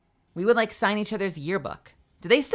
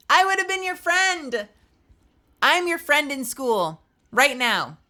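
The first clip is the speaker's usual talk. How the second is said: excited; 9 semitones higher, 6 dB louder, slower than usual. Real, not acted. Eager reassurance to lonely viewer.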